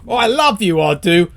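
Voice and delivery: gushing adoration. Acted. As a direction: gush it at him like an overeager admirer. Mock gushing at the professor.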